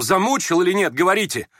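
high, urgent command; agitated demand. A narrator's reading. Trembling youth ordering Yevgeny Pavlovich to answer, agitated. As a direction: press him hard for an answer. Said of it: "Дрожал и приказывал Ипполит".

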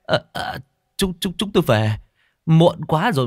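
high, halting, nervous excuses; flustered, high-pitched retreat; cowed stammer. Acted. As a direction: back out timidly, tripping over words. Cowed guests stammer excuses to leave.

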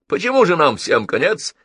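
high-pitched mocking question; smirking, incredulous query; amused jeer. A narrator's reading. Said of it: "усмехнулся Геннадий".

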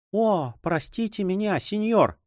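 high, urgent plea; pleading exclamation. A narrator's reading. Girl hurrying to her guardian, begging forgiveness. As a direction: cry out an apology, voice pitched well up. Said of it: "воскликнула Донья Хуана".

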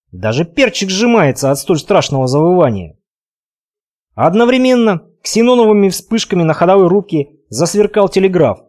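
plain reading voice

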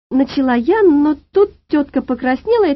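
plain reading voice

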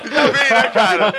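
words through real laughter; laughing while talking. Real, not acted. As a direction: talk while cracking up. Laughing at friend's attempt.

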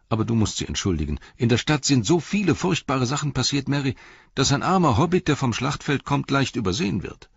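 a lively hobbit relieved to find his friend; Pippin, eager young hobbit. A narrator's reading. Pippin voiced in reading.

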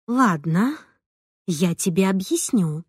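ordinary delivery

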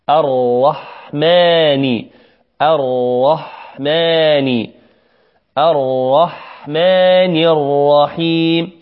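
slow الرحمن with one heavy untrilled r; correct raa. Shown as a demonstration. Teacher models الرحمن without repeating the raa. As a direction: hold the raa without rolling. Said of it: "إنما نقول الرح".

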